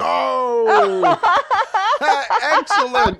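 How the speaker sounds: high, laughing delight. Real, not acted. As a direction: burst out laughing with glee. Reacts to April Fool reveal.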